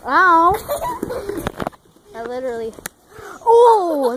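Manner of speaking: sharp yelp of real pain. Real, not acted. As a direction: cry out from a hard hit. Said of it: "that actually hurt".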